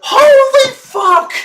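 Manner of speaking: very high, slow strained cry; real shocked outburst; stunned yell. Real, not acted. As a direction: let the disbelief crack out. Disbelief at coach decision.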